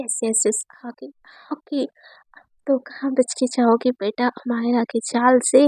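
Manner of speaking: hushed, gloating whisper; gleeful whisper. Acted. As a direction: whisper in sneaky triumph, slow at the end. Girl gloats secretly as target arrives. (शरारत से फुसफुसाती हुई खुशी)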